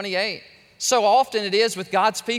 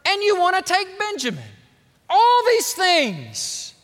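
whiny Jacob; 9 semitones higher, 5 dB louder, slower than usual. Acted. Preacher voicing Jacob's complaint.

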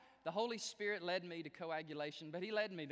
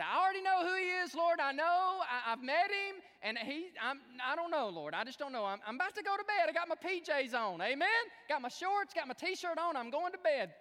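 high, loud, rattled-off excuses; loud mock protest; 11 semitones higher, 10 dB louder than usual. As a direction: plead your way out at full volume, hammed-up. Acting out his protest to God.

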